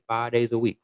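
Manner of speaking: usual speaking voice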